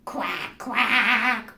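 duck quack. Acted. Greeting the pizza duck at the door, playful quacking. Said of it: "Then what would you say?".

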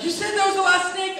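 high, loud, upset mock protest; scared, high-pitched outrage; panicked accusation. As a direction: squeal the accusation like a betrayed little brother. Terrified brother accuses teller.

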